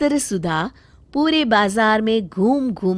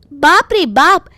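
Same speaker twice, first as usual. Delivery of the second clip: higher, louder indignant bargaining; the wife refuses to be fooled; shocked haggling wife; 10 semitones higher, 7 dB louder than usual. Acted. Sudha haggling, shocked at price. (भाव सुनकर चौंकी, ऊँची तकरार)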